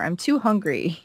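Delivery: normal talking voice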